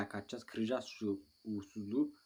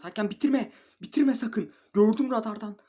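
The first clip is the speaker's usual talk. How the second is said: much higher, much louder pleading yell; frantic shout; 11 semitones higher, 12 dB louder than usual. Real, not acted. Gamer yelling at the referee not to end the match, a frantic shout. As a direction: beg the ref not to blow.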